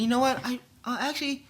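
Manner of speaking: lightly voiced coworker telling him she likes it busy; coworker quoted. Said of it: "She said".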